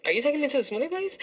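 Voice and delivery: high, quick retold question; incredulous question. Woman retelling a question to her date, incredulous.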